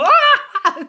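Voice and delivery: loud, high scream breaking into laughter; mock startled scream. Showing how the voice still scares her, mock scream. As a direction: scream as if suddenly spooked, playfully. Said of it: "I still go".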